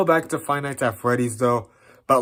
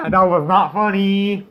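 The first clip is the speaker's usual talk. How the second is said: shaken; 8 semitones higher, 4 dB louder than usual. Real, not acted. After jump scare.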